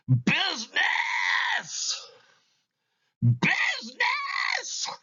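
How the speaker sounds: the ghost wails out in despair, slowing on the second; anguished ghostly cry. Acted.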